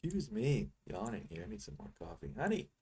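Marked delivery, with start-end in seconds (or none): 2.4-2.7 high call across the house to his wife. Real, not acted. Trader calling to his wife in the next room, high call. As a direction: sing out her pet name from the desk.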